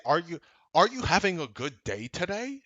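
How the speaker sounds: mock the easy question he'd never ask. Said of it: "I didn't say".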